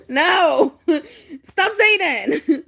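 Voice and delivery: slow, through real laughter; laughing. Real, not acted. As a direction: beg them to stop while cracking up. Laughing at a friend's teasing.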